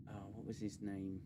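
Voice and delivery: ordinary talk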